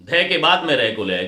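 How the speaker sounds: usual speaking voice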